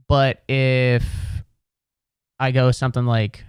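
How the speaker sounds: ordinary delivery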